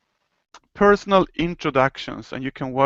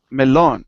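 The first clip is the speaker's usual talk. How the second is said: melón, land firmly on LÓN; teacher models melón with the stress on LÓN; 3 dB louder than usual. Shown as a demonstration. Teacher models melón for student, stress on LÓN. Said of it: "make an emphasis on that part of the word".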